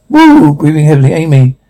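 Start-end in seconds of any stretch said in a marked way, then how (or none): 0.1-0.5 high-pitched "woo" cheer; whooping cheer. Acted. Reader voicing a party crowd's cheer, high whoop.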